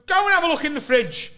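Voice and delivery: high, loud, accusing; mock angry order. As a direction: order him like an outraged flatmate. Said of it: "he's proper screaming at me".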